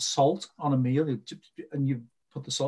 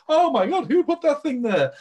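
loud, high outburst of mock shock; shocked discovery; 12 semitones higher, 7 dB louder than usual. Someone trips over a hidden body, acted shocked exclamation. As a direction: exclaim it in hammed-up alarm. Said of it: "trip over this body and go".

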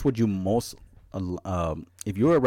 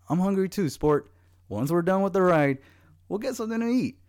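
dad voice; 8 semitones higher, 3 dB louder than usual. Retold or imitated.

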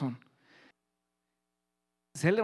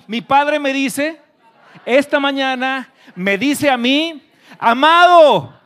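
high, slow, booming proclamation; shouted declaration; 9 semitones higher, 15 dB louder, much slower than usual. Real, not acted. Preacher giving words to the congregation to declare, full shout. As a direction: proclaim it at full voice, stretching each phrase. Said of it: "dilo más fuerte".

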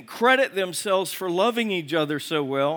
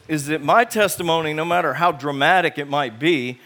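ordinary delivery

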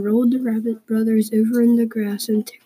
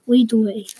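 ordinary delivery, nothing marked

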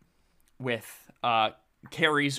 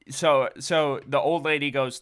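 ordinary talk

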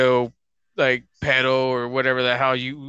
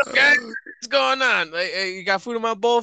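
dog's voice; 7 semitones higher than usual. Retold or imitated. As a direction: play the dog strolling in without a care. Voicing the dog walking in.

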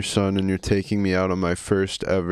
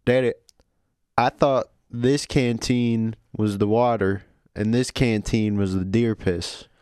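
put-on Southern accent. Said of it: "Southern accents?".

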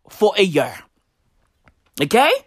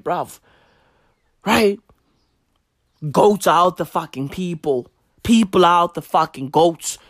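ordinary talk

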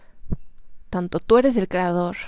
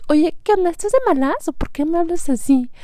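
much higher and louder, mock-confrontational challenge; provoking retort; 10 semitones higher, 5 dB louder than usual. To a rude phone agent, meaning to annoy her more, a higher, louder mock challenge. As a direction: throw it back at her to wind her up. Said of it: "como diciéndole".

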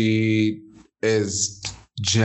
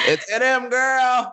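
high, sassy emphasis; high emphatic. Real, not acted. Emphatic gossip about Jack.

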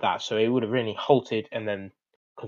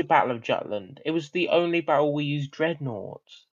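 usual speaking voice

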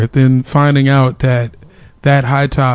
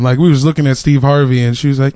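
ordinary delivery

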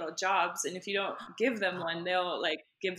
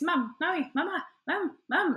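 put-on high-pitched pestering toddler; insistent little-kid nagging, pitched up; Stewie from Family Guy; 9 semitones higher, 4 dB louder than usual. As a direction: imitate a child calling for attention over and over. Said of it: "he was like Stewie, like, from Family Guy".

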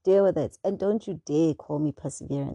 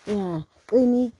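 normal talking voice

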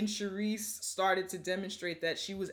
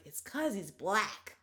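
slow, put-on classmate voice; slower than usual. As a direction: drag it out like a clueless kid. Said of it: "she was like".

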